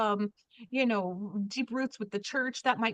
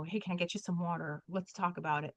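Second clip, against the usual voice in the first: softer, lower, soothing; calming voice; 4 semitones lower, 7 dB quieter, faster than usual. Shown as a demonstration. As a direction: ease an upset person down gently. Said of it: "Sometimes if I lower my voice".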